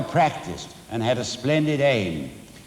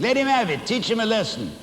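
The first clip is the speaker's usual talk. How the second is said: put-on shrieking old woman; 9 semitones higher, faster than usual. A narrator's reading. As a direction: cheer on the caning like a nasty spectator. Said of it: "she was shrieking".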